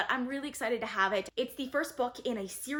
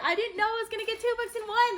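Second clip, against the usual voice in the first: delighted and much higher, a little louder; excited; 10 semitones higher, 4 dB louder than usual. Real, not acted. Booktuber thrilled to find two books in one, excited. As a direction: let the happy surprise lift the voice right up.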